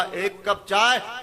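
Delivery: slow, dragged "एक cup चाय"; weary request. Acted. Tired husband comes home and asks his wife for tea, slowly. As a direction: ask for tea as if worn out by the day.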